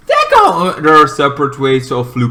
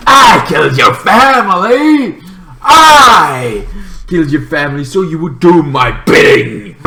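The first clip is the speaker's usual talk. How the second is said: higher, louder gloating yell; villain shout; 5 semitones higher, 5 dB louder than usual. Retold or imitated. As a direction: hurl the reveal in triumph. Villain's reveal.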